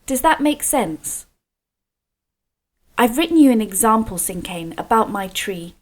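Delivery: ordinary talk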